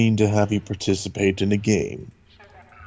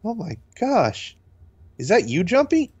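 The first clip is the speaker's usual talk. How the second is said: put-on surprised girl voice; 12 semitones higher than usual. Voicing surprised girl character.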